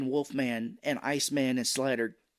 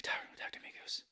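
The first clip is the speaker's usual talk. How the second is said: whispered plea to a ghost; 14 dB quieter, faster than usual. Retold or imitated. Podcaster whispering Maverick's line over the dog tags. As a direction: breathe it out to the dead.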